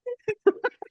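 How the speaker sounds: sudden squeal of a laugh; high laugh at a friend's teasing; high burst of laughter. Real, not acted. Laughs at the tease.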